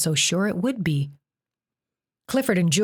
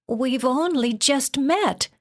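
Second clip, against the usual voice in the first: put-on higher, slow frightened girl; Jen's voice; 8 semitones higher, slower than usual. A narrator's reading. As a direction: answer timidly in a girl's voice. Jen answering after the slap.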